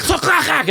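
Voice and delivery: high, percussive clattering noises; imitated clattering. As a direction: mimic cutlery hitting the ground in quick bursts. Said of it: "you'd be like".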